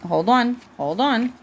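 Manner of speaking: ordinary delivery, nothing marked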